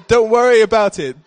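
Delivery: a hint of a fussing old auntie; old lady reassuring. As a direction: wave away the broken cup kindly. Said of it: "That's an Indian accent".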